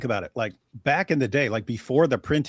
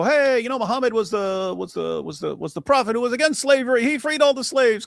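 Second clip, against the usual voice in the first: mocking preacher voice; 9 semitones higher than usual.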